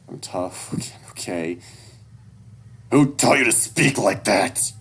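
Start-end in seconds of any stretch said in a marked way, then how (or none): 2.9-4.7 loud, slow, stern question; slow angry demand. Acted. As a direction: demand an answer, furious. Dad angry at son's cursing.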